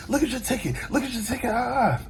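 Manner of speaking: huffy friend imitation. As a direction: mimic an exasperated friend up high. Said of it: "he's huffing and puffing".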